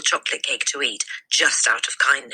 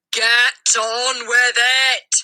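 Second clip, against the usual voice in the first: much higher and louder, dragged out very slowly; furious shout; 9 semitones higher, 5 dB louder, much slower than usual. A narrator's reading. Headmistress glaring at the boy, slow furious shout. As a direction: bellow each word like a command. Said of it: "she shouted".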